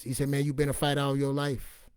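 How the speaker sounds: normal talking voice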